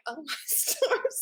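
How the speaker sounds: slow, laughing "oh my stars"; laughing disbelief. Retold or imitated. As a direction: laugh through the words.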